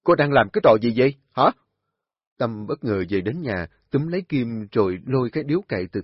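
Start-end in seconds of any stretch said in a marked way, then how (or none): 0.0-1.5 loud, fast scolding; angry demand. A narrator's reading. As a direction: snap at her furiously. Tâm bất ngờ quát Kim. (quát hỏi giận dữ)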